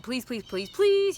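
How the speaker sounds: pleas jumping high and loud on last "please"; retold begging. Client begging her for a video, retold pleading. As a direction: imitate begging, punching the final "please".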